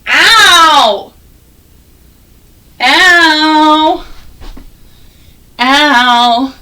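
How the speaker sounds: mock ow. Shown as a demonstration. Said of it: "this O W says".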